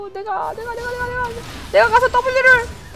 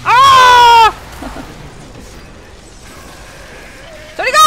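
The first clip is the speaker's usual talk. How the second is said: sudden loud high yell; startled shout; 7 semitones higher, 7 dB louder, much slower than usual. Real, not acted. Gamer yelling at chasing enemies, loud shout. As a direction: yell to get them off you.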